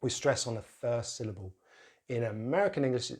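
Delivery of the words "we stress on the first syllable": ordinary talk, nothing marked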